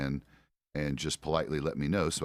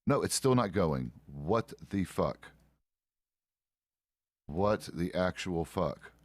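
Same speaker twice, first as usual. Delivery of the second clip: normal talking voice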